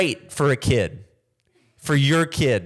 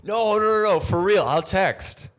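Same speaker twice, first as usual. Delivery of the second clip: insincere brush-off. Retold or imitated. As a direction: promise to text but mean never. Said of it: "You go".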